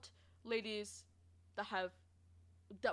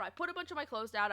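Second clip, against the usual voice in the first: usual speaking voice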